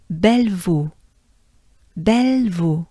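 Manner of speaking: Bellevaux as bel-vo, stress at end; French Bellevaux. Shown as a demonstration. Teacher modelling the town name Bellevaux. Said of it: "Not too bad".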